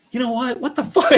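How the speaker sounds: high-pitched comic indignation; mock outburst. Man retelling his bad mood days later, mock indignant.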